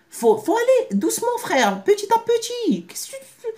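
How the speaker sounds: very high, put-on preachy voice of a friend; high cartoon voice urging patience; mock advisor voice. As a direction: squeak out the advice like a caricature. Voicing advice to go slowly.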